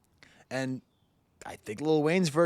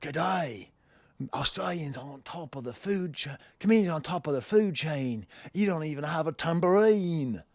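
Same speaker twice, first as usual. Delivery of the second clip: hammed-up mock Australian accent; 3 semitones higher than usual. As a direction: do a bad Aussie accent that slips toward British. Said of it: "I'll do an Australian accent".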